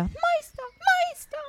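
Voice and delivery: very high, small squeaky voice; thin, high-pitched cry for the master; harp calling out. A narrator's reading. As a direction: pipe it out as the magic harp. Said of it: "rief die Harfe wieder".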